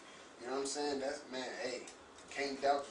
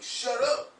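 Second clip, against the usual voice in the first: commanding shout; 9 semitones higher, 8 dB louder than usual. Retold or imitated. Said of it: "I was like".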